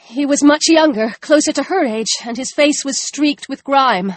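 plain reading voice

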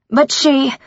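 high, sharp cut-off protest; angry maid interrupted mid-word; indignant protest. A narrator's reading.

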